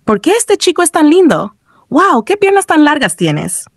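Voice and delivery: high-pitched delighted admiration; amused exclamation. A narrator's reading. Player admires Kissy's long legs, amused exclamation. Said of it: "entre risas dijo".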